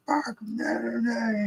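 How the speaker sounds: high, soft mocking driver. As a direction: voice the annoyed tailgater mockingly. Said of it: "they're like".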